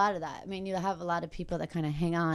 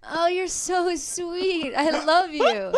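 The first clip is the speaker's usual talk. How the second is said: touched; 9 semitones higher, 5 dB louder than usual. Real, not acted. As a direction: melt at the kind words. Touched by the caller.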